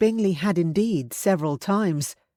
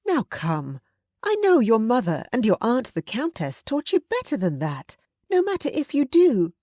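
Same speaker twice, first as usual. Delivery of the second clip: higher, firm matronly voice; stern aunt; 7 semitones higher than usual. A narrator's reading. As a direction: scold him like a frank aunt.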